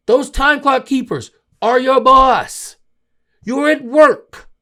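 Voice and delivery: slow, loud and high preaching rebuke; heated lecture. Real, not acted. Host lecturing an NBA player, loud slow heated rebuke. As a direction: hammer home that refs are boss.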